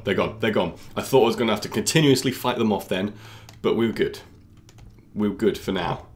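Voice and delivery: usual speaking voice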